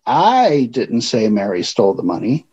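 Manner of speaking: "I" punched, rest of the line even; stress on I. Shown as a demonstration. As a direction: lean hard on the first word "I". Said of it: "because of the way I emphasized a word".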